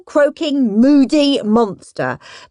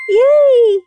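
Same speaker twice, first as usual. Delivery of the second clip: high, loud happy cheer; yay; 11 semitones higher, 6 dB louder than usual. Acted. Peter cheering when he comes first, high and loud. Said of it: "said Perfect Peter".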